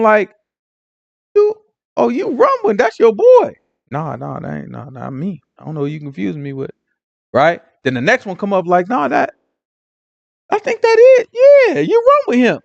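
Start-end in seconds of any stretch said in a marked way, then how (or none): 1.3-3.5 high-pitched mock callout; pitched-up, teasing-style accusation; acted accusation. First accuser acted in recap. 3.9-6.7 low, soft mock brush-off; hushed, low denial under suspicion; evasive denial. Peter's evasive denial, acted. 8.9-12.6 pitched-up mock callout; acted recognition. Next accuser acted in recap.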